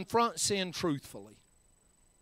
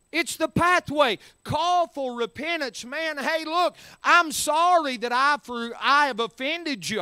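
high, forceful sermon delivery; preaching; 6 semitones higher, 6 dB louder than usual. Real, not acted. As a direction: preach it out with rising urgency.

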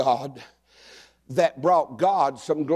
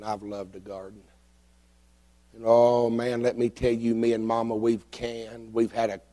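ordinary talk, nothing marked

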